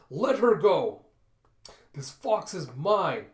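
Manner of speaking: loud, high commanding yell; fierce shout. Acted. Yafaela flying in with a knife, fierce shout. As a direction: shout it with full attack. Attacks monster to save fox.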